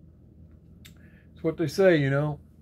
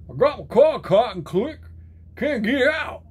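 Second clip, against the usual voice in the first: loud, high-pitched mock Scottish; hammed-up Scottish accent, pitched way up; attempted Scottish accent; 7 semitones higher, 5 dB louder than usual. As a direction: have a rough go at a Scottish accent. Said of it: "try to do a Scottish accent".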